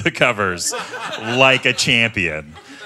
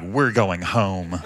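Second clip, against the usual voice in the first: calm firm dog talk; 5 semitones lower, 3 dB quieter, much slower than usual. Retold or imitated. Scolding tiny dog under arm.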